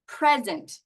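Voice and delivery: "present" as PREZ-ent, falling on the second syllable; stress on first syllable. Shown as a demonstration. Teacher repeats the gift word for learners, stress on first syllable of "present". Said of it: "depending on how I say it".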